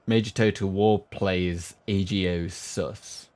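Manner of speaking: normal talking voice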